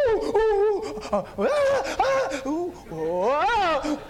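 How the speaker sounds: high, loud mock panicked oohs and aahs; imitated passenger screams. Passengers crying out on a rocking plane, hammed-up screams. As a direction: wail like frightened passengers on a lurching plane. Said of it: "And you can hear people".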